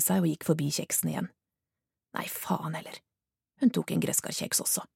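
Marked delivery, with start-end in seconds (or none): 2.2-3.0 soft whispered give-in; hushed resigned swear; whispered curse. Acted. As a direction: say it to yourself, almost silent. Jet alone giving in to temptation.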